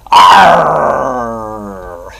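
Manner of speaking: loud hammy pirate roar; pirate growl. Acted. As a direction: bellow it like an old sea dog. Pirate catchphrase after intro.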